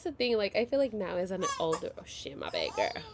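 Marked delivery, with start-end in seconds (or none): none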